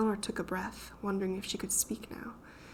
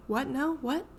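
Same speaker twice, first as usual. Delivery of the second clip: high startled questions; jolted awake and confused; 6 semitones higher, 3 dB louder, slower than usual. A narrator's reading. Theodora just woken by Eleanor's shout, startled. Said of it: "startled and disheveled".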